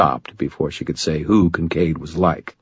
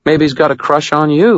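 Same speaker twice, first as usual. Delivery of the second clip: higher, teasing woman's voice, rising on "you"; mocking echo; 10 semitones higher, 4 dB louder than usual. A narrator's reading. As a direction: toss "Maybe he's got a crush on you" back, rising on "you". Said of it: "Murphy snorted".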